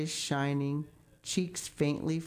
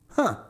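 a short scornful sniff; 4 semitones higher, 6 dB louder than usual. A narrator's reading. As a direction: sniff at it dismissively. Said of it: "sniffed Marilla".

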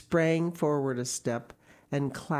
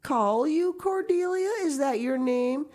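much higher, puzzled; incredulous Marilla; 14 semitones higher, 5 dB louder than usual. A narrator's reading. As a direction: question it in disbelief.

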